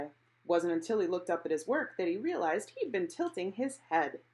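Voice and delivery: usual speaking voice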